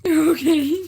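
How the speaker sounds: very loud, high scream; loud high scream, real; screaming okay. Real, not acted. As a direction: shriek it out. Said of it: "why am I screaming".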